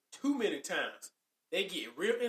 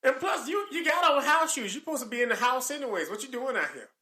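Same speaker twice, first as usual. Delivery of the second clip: mock scolding; 5 semitones higher, 6 dB louder than usual. Reenacting scolding over house shoes.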